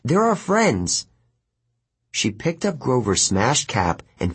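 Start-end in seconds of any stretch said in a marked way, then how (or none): 0.0-1.1 high, drawn-out appeal; insistent. A narrator's reading. Annabeth appeals for her missing friends, insistent. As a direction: press it slowly, pitch up. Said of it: "Annabeth insisted".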